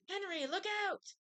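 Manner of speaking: teacher crying out a warning as the paint tips; mock scream. A narrator's reading. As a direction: shout a warning to Henry. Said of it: "screamed Miss Roop".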